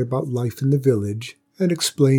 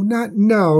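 the plea breaks much higher and louder; higher, louder cry; 10 semitones higher, 4 dB louder than usual. A narrator's reading. Mother dragon pleading over her baby, rising anguished cry. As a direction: cry it out, higher and more desperate. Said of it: "cried the dragon".

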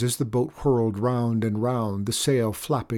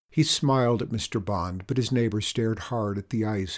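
plain reading voice

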